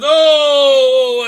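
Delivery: long, loud wail of "oh"; hammed-up cry of dismay, high and drawn out; mock grief. Madam Ching over a dead person, mock grief. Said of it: "and she's very sad".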